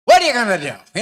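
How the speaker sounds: sudden loud, high taunt; kid's loud challenge to mom; defiant challenge. Acted. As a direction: burst out loudly, daring the parent to act.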